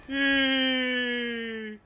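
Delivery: high, loud, drawn-out yay; long squealed hurrah; excited cheer. Real, not acted. Cheering at the game.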